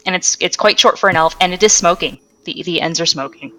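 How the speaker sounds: ordinary talk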